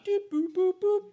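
high beeping doop boop; high playful dial-tone noises; phone dialing beeps. Pretend. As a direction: beep like pressing buttons. Mimicking dialing God.

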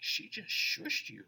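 slow whispered remark; wife whispering, amused; whispered aside. Retold or imitated. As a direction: lean in and whisper it. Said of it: "Michelle's like".

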